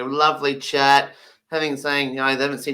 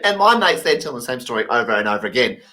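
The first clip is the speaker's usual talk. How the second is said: ordinary talk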